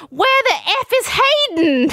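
very high-pitched comic holler; imitating a frantic cry across a party; mock yell. Someone suddenly yells amid the chaos, mock frantic. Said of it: "someone yells".

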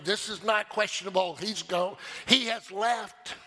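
high, driving pulpit delivery; impassioned preaching. Real, not acted. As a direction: preach it with full energy. Preaches with rising force.

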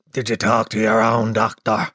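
low, croaky receptionist impression; chain-smoker receptionist.

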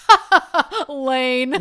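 loud, high fake guffaw; imitating husband's laugh. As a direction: ham up his schmoozing laugh at the mayor. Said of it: "that's how he sounded".

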